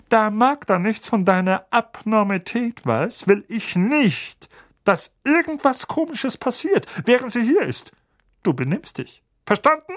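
high, put-on voice of a bullying uncle. A narrator's reading. Uncle forbidding anything odd, bullying. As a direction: drill each word into the boy. Vernon warns Harry.

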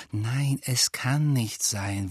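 slow, grim denial. A narrator's reading. Hermine rejecting Ron's charge of naivety, grim. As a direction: deny it slowly and grimly. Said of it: "sagte Hermine grimmig".

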